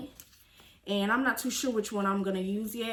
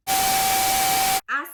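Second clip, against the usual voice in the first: dramatic high wail; 7 dB louder than usual. Pretend. Long high dramatic stretch.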